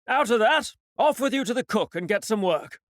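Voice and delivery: put-on stern captain shooing the ship's boy; captain's order. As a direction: send the boy off sharply.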